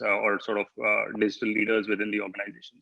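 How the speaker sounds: ordinary talk, nothing marked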